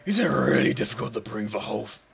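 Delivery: low, lightly layered demon voice; a hint of a menacing demon. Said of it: "I might go with my demon voice again".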